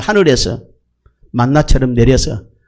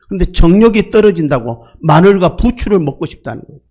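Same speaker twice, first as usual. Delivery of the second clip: normal talking voice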